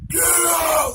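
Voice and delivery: high, full-force scream; loud scary shout. Retold or imitated. Possessed friend ordering the boys out again, screamed loud. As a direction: shriek it as loud as possible. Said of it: "Loud as could be".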